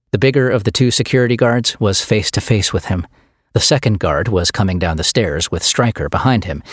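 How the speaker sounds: plain reading voice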